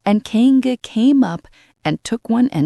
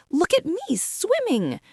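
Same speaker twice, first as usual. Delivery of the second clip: put-on much higher, squeaky baby kangaroo; squeaky little Roo; 11 semitones higher than usual. A narrator's reading. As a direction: squeak it like a tiny proud child. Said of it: "squeaked Roo".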